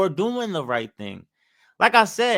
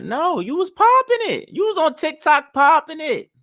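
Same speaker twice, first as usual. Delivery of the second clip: much higher and louder, scornful; sharp call-out; 14 semitones higher, 5 dB louder than usual. Real, not acted. Vlogger calling out a TikTok lady who bragged. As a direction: throw it back in her face.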